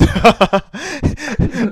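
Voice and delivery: a shrill cackle of delight; high shrieking laugh. Real, not acted. Laughing at a friend's badass claim with a high squeal.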